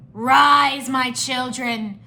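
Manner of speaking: loud, drawn-out villain command; shouting Skull Sorcerer. A narrator's reading. Skull Sorcerer wakes skeleton warriors, commanding shout. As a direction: summon minions with a grand shout. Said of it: "the Skull Sorcerer shouted".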